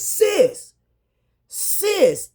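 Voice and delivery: high, calling voice of a friend; calling out sis. Retold or imitated. Friend calling through the stall, high call.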